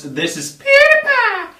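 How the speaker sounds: PewDiePie screamed high and loud; high, loud shouted name; catchphrase shout. Acted. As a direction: blast your own name like an intro jingle. Signature intro catchphrase.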